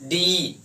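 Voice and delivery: slow "डी" with a long held ee; drawn-out final vowel. Shown as a demonstration. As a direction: stretch "डी" so the ending ee stands out. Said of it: "ई आ रहा". ("डी" की ई को लंबा खींचकर)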